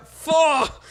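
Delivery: high, drawn-out shout of frustration; frustrated curse. Real, not acted. Botching the American accent attempt, frustrated high shout. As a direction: burst out cursing at your own slip.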